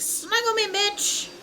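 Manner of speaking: high, loud, cheeky creature squeal; playful shark voice. Pretend. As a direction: pretend to be a pushy shark wanting cuddles. Voices sharks greeting people's hands.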